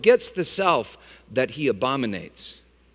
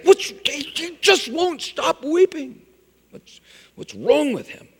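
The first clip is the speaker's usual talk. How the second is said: mock baffled onlooker; 10 semitones higher than usual. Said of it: "We'd call a psychiatrist".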